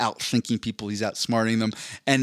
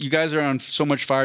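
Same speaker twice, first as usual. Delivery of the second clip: ordinary talk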